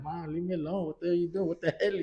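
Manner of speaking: high, soft whiny daughter voice, lightly done; annoyed teen daughter. Retold or imitated. Daughter whining at mom to stop taking pictures. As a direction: imitate a fed-up teen brushing off her mom. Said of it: "She's like".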